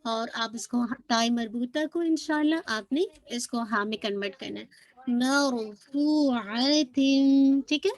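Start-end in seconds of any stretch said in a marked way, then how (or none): 5.1-7.6 मरफूअतिन very slow, fu held and the end hummed; stretched recitation. Shown as a demonstration. Teacher models the word, slow recitation. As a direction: draw out मरफूअतिन syllable by syllable. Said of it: "to count आपने stretch करना".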